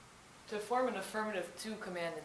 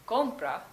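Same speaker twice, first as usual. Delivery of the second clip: "compra" punched on the first syllable; stress on the O; 3 semitones higher, 6 dB louder than usual. Shown as a demonstration. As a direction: say "compra" with a strong COM, then a light falling "pra". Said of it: "the emphasis is on the O".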